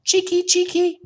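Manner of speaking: very high, loud chattering cheeky; scolding swallows. A narrator's reading. Swallows scolding someone entering the barn, shrill chatter. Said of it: "they say".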